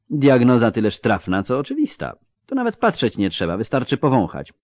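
brisk, brightly voiced halfling surgeon; lightly voiced doctor, dry and matter-of-fact; surgeon Rasty. Halfling surgeon assessing a wounded man, dryly brisk.

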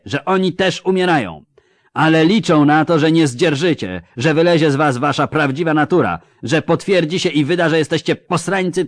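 loud, mocking commander addressing his troops; drwiący wojewoda. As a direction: sneer at the pikemen like a scornful noble officer. Voivode speech. (szyderczy wojewoda przemawia głośno do pikinierów)

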